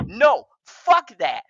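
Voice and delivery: ordinary talk, nothing marked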